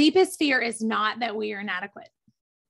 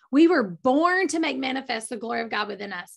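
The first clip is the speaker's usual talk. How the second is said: normal talking voice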